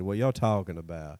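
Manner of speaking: lazy Southern drawl on "What y'all talking about"; light Southern accent. Shown as a demonstration. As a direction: stretch it out Southern style. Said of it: "that's the Southern accent we need".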